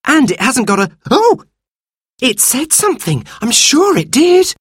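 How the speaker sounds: put-on high child's voice leaping on a sudden "oh"; startled girl. Sister hears buried creature speak, high startled excitement.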